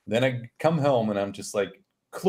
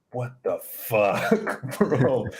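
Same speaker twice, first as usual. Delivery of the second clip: slow, drawn-out incredulity with a laugh; stunned and laughing; laughing disbelief; much slower than usual. Retold or imitated. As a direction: stretch the words, then crack up. Stunned realization about the discrimination.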